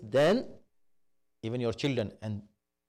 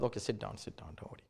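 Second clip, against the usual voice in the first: soft, hushed, soothing father; quietly calm the angry son; 4 semitones lower, 10 dB quieter, faster than usual. Retold or imitated. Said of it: "The father was very calm".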